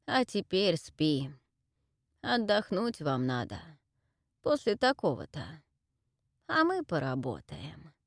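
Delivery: slow, low, lulling crone; old witch voice. Acted. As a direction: lull her to sleep like a witch. Witch lulls heroine to sleep. (медленный голос старой ведьмы)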